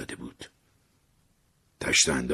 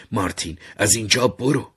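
mild wail, louder and higher; raised, lamenting voice; wailing plea; 7 semitones higher, 7 dB louder than usual. Acted. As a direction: cry out as a pleading, wailing mother. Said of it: "مادرم شیون کنان گفت".

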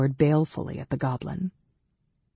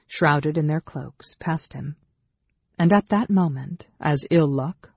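ordinary delivery, nothing marked

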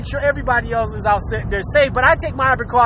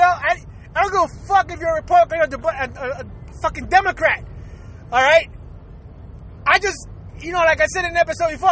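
usual speaking voice

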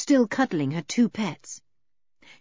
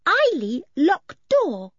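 put-on very high, slow childlike voice; little one reporting in broken phrases; childlike Eily; 11 semitones higher, 4 dB louder, slower than usual. A narrator's reading. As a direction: speak simply in a high, drawn-out singsong. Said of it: "said Eily suddenly".

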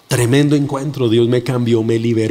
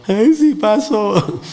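mock lament; 6 semitones higher, much slower than usual. Mock regret after accident.

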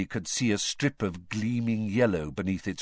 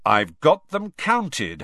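slow, louder stern warning; 6 semitones higher, 6 dB louder, slower than usual. Acted. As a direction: put your foot down, slowly. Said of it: "said Charlie sternly".

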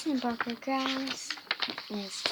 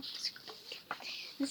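a tiny, much softer squeak breathed with no voice; whispered squeak; 2 semitones higher, 11 dB quieter than usual. Pretend. Whispered squeak, then loud squeak.

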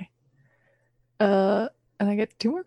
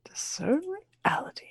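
whispering; 11 semitones higher, 5 dB quieter than usual. Real, not acted. Repeating roll name to herself.

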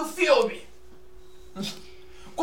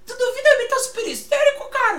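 Super Histérico impression; 8 semitones higher than usual. Shown as a demonstration. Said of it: "Vou imitar agora o Super Histérico".

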